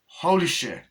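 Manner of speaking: loud, high acted astonishment; shocked. Viewer reacting to the severed hands, shocked. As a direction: blurt it out in shock.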